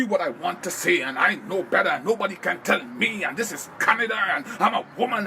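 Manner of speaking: mock a self-righteous, won't-be-told rant; mocking know-it-all. Mimicking a person who listens only to reply, mockingly. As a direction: imitate someone stubbornly running on over objections.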